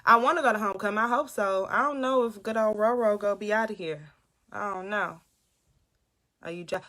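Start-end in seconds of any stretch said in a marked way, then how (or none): none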